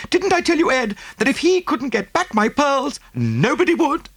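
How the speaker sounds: very high, triumphant woman's voice; boastful rich wife. A narrator's reading. Mrs Oppelson boasting to Ed, very high and triumphant. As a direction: crow about being right.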